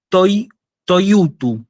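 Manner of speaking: slow, careful "toi-, toyutu", first part set apart; demonstrated reading. Shown as a demonstration. Teacher showing students how a Greek word is read, careful pronunciation. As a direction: model "toi-, toyutu" slowly, starting with "toi" alone. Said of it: "Esto se lee".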